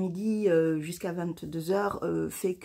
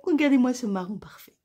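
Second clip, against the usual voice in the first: higher, louder and fast, gushing; excited; 5 semitones higher, 4 dB louder, faster than usual. Real, not acted. Knitter showing viewers her new brown polish, gushing. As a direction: show it off thrilled.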